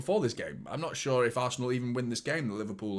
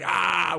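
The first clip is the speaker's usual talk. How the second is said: sharp mock roar, a bit louder; imitated celebration roar; 6 semitones higher, 7 dB louder than usual. Fan mimicking Gabriel roaring in Haaland's face, mock roar. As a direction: bark out a short mock yell like Gabriel. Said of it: "Apparently Gabriel went".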